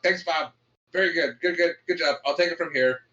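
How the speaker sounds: retold hurried brush-off. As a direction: mimic someone butting in to take over. Doctor rushes out, takes over from Bob.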